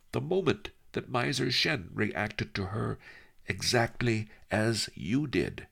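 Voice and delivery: put-on a sly old scholar savouring the joke; lightly voiced old Master Li, amused; old sage Master Li. Li Kao teasing in story.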